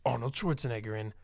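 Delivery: movie trailer voice. Retold or imitated. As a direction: read it like a blockbuster trailer. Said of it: "overdub trailer voice guy".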